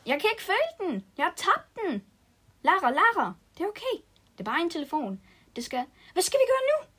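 high, loud frantic cry; panicked. Acted. Girl realises she lost her phone, frantic and loud. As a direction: spiral into panic.

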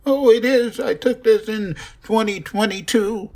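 high put-on parent voice insisting; mock insistent parent. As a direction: squeak it, defending the old photo. Mocking future parent's voice.

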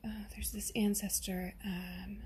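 hushed whisper, like revealing a secret; mock psychic whisper. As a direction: whisper it as a fake medium building mystery. Mock psychic reveals found ancestor.

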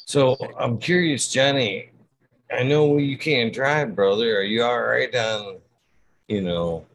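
calling out, high and loud. Real, not acted. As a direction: call across the room to a friend. Calling out to Johnny across room.

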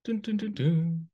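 high sung dun-dun-dun; mock suspense sting. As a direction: hum the ominous jingle. Suspense sound effect.

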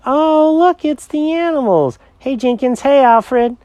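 put-on high singsong children's voices; kids greeting pets. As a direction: coo a greeting like excited kids.

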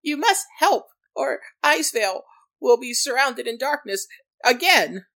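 high, loud dire warning; frantic gnome. Acted. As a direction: warn them the end is coming.

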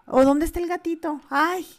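a touch of a tactless, curious stranger; mocking nosy stranger. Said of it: "cuando dicen eso".

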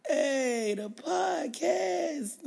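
slow, laughing tired drawl. Real, not acted. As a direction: let the words sag, tired. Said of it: "you sound so tired".

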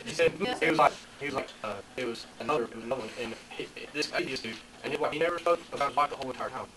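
usual speaking voice

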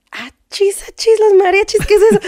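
playful surprised exclamation; nosy surprise. Retold or imitated. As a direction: act out catching them in the act. Spying from terrace, surprised.

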